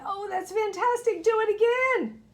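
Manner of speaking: high, loud, thrilled cry; excited shout. A narrator's reading. Elizabeth urging the tired dragon on, shouting. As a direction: shout it out in delight. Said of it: "but Elizabeth shouted".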